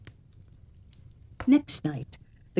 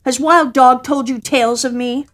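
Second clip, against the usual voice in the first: a touch of anger, louder and higher; cat bristling with offended pride; angry cat; 5 semitones higher, 7 dB louder than usual. A narrator's reading. Cat angrily challenging the woman. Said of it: "Cat grew very angry and said".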